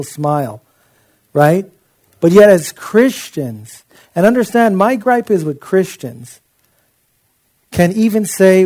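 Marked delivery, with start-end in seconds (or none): none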